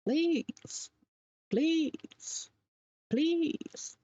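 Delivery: high-pitched drawn-out mock pleas; begging. Woman mimicking a man begging in his postscript, mock pleading. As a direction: beg in a high voice, each word on its own. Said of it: "when I see or read a man beg".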